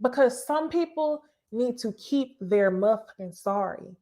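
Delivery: normal talking voice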